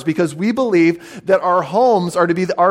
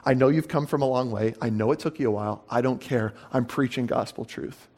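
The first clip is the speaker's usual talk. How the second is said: softer, low and firmly quiet; a hushed but unshakable retold Jesus; quiet resolve; 5 semitones lower, 9 dB quieter than usual. Jesus tells the Pharisees he'll preach anyway, softly resolute. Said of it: "He's like".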